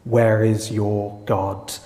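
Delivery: drawn-out mocking question; slow it right down like a jeer; slow taunt. Nations taunting the psalm writer, a slow mocking question. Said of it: "the nations say".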